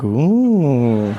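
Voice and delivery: long, swelling "ooh"; awed "ooh". Retold or imitated. Audience member reacting to the riddle, drawn-out awe. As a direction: draw out an impressed ooh.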